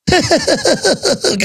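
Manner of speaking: high sinister gangster chuckle; a hammed-up villain's laugh; guard laughing at the reporter in a mock mobster cackle. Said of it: "Actually laughed like that while smoking a pipe".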